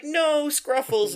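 put-on high, slow, wailing little girl voice; mock-sobbing girl. Girl mourning her dead cat, wailing her pet's name. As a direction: stretch out the name in a hammed-up wail. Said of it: "and she's like".

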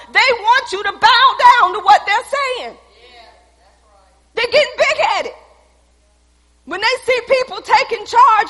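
loud, high, driving preacher's cry; preaching at full force. Acted.

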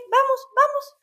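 put-on high, eager child voice pitched well up; high kid's "vamos, vamos"; little boy voice. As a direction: play the small son pulling mom along, high. Imitating little son's voice.

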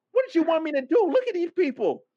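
high-pitched indignant protest; exasperated retort. Retold or imitated. Traveler answering the girl at the front, exasperated. Acted retort at the boarding line.

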